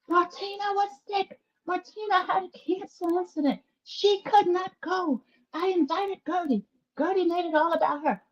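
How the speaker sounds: high-pitched mocking retelling of a tirade; mocking Julia. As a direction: mimic her repeated complaint in a high put-on voice. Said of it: "Julia is recounting the cruise story again".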